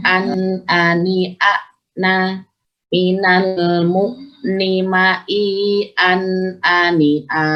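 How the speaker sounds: slow recited syllables with clear glottal attacks; measured drill, "minal" and "ma, i" drawn out; hamzah drill. Shown as a demonstration. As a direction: chant "an, ani, a" evenly, pressing each hamzah. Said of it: "Nah, ini huruf hamzah".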